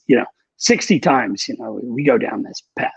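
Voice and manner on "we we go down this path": ordinary delivery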